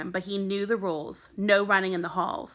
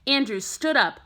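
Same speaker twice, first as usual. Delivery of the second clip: ordinary delivery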